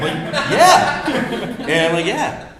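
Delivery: high, loud laughing yeah. Real, not acted. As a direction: giggle out the sheepish yeah. Laughing, mimicking own reply.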